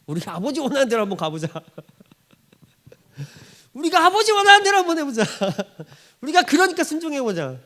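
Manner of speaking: words breaking into laughter, climbing high; cracking up mid quote; laughing while quoting. Acted. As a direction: laugh your way through the line.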